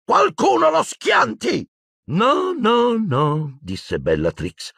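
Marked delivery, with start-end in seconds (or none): 0.0-1.7 very high, drawn-out shout; strained, urgent shouted order; yelled. Acted. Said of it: "che questi urlò". 2.1-3.6 high gleeful refusal, stepping down; crazed high excitement; excited, possessed. Acted. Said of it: "Sembrava invasata, fremente di eccitazione".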